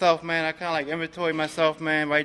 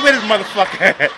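louder, laughing mock fury; mock angry demand; 3 semitones higher, 8 dB louder, faster than usual. Dean, out of key tags, hams up his grudge with a laugh. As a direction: bark it like a half-joking grudge. Resentment at the GSR.

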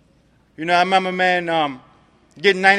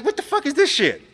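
high, shocked "what the fuck"; disbelieving outburst; 9 semitones higher than usual. Retold or imitated. Newcomer at his first meeting, voicing his shocked disbelief. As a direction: blurt it out in sudden disbelief.

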